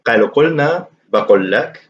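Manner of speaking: قالوا قلنا, بقول لك with q pronounced back in the throat; qaf as q. Shown as a demonstration. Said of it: "you can learn whichever you want".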